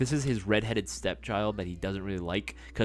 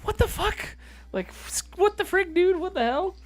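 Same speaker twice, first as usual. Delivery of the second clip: pitched way up, incredulous; high outraged disbelief; 5 dB louder than usual. Acted. Streamer mock-outraged at his friend's take. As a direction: squeak out the outrage.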